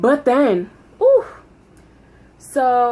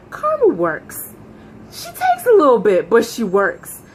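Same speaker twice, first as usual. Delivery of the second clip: smug satisfaction; 4 dB louder than usual. Retold or imitated. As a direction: savor it like sweet revenge. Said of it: "I was like".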